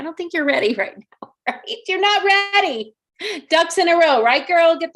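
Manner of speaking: put-on loud, high, teasing "girl" voice; sassy put-on voice. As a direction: hype her up like a sassy best friend. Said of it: "I don't even know why I'm talking like this".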